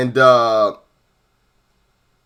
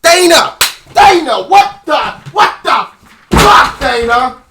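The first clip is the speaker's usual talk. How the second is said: screaming his name, much higher and louder; furious shout; 6 semitones higher, 7 dB louder than usual. Real, not acted. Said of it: "I just threw my fucking chair".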